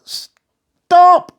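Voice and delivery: high, loud calls to halt; shouted stop. A narrator's reading. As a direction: chasers yelling after them. Crowd chasing, shouting.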